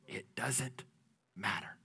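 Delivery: whispered, dropping to almost nothing. Real, not acted. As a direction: hush it like a secret. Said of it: "here's what I'm here to tell you".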